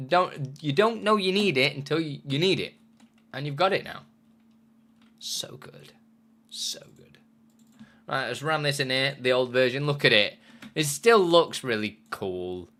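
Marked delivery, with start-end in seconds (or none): 5.2-7.2 breathy whispered delight; whispering. Real, not acted. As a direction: whisper it in awe.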